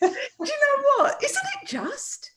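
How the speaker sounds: very high, slow, drawn-out mock voice; playful high voice. Joking about arguing in silly voices, high-pitched. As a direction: stretch it out high, on the edge of laughter.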